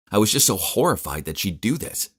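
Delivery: usual speaking voice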